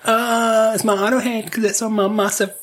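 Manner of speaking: put-on high, bratty whining kid voice; whiny young Bruce. Mocking young Bruce over his arrowhead, high and whiny. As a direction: whine like a spoilt little rich boy. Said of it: "Quite whiny".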